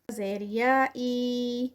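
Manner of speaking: ای drawn out very slowly for two counts; stretched ee. Shown as a demonstration. Teacher showing students how to stretch ای. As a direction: hold the ای long, two full counts. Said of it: "یہ تھوڑا سا لمبا ہوگا اس کا stretch ٹو counts کا".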